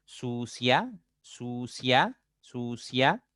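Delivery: "sucia" drawn out, rising onto the final A; slow pronunciation demo. Shown as a demonstration. Teacher drilling the I-A diphthong, "sucia" stretched and rising. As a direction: repeat "sucia" slowly, lifting the "cia". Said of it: "al momento de pronunciar la A".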